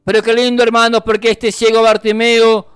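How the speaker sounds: sustained, insistent preaching delivery; fervent preaching. Real, not acted. Preacher exhorting the brethren to keep crying out, fervent. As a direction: preach it urgently to the congregation.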